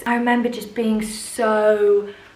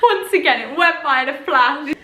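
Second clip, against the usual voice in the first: mock dramatic reading; 7 semitones higher than usual. Mocking the diary line.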